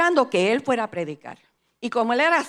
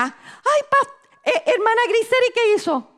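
mock alarm; 12 semitones higher, 4 dB louder than usual. Voices someone's startled question to her.

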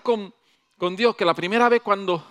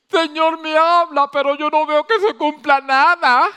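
queja alta y fuerte de un creyente frustrado; reclámale al Señor, más alto y fuerte; creyente quejoso; 8 semitones higher, 8 dB louder than usual. Acted. Voicing a complaining believer. (el creyente se queja ante Dios, alto y fuerte)

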